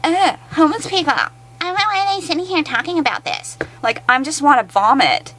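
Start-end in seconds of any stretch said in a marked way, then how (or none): 0.0-3.6 much higher, dismissive sneer; mock clueless local. Vlogger mocks locals dismissing homeless people, high sneering voice. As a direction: brush it off like a clueless neighbor.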